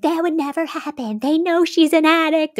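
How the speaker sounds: incredulous viewer objecting; mock protest. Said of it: "I was like".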